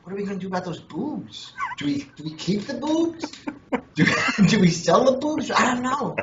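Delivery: mock puzzled musing. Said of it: "I'm going".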